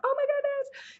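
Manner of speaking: very high gushing squeal; fangirl squeal. Retold or imitated. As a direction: gush like a starstruck fan. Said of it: "It's not like you can".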